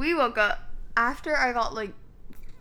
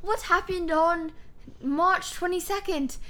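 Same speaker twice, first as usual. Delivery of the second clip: 1 curious grandchild; 6 semitones higher than usual. Pretend. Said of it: "your future grandchildren will ask".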